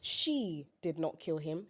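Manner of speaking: she lifted, slow and soft; stress on she. Shown as a demonstration. Showing a friend how to stress She, slow and soft. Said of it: "put emphasis on certain words".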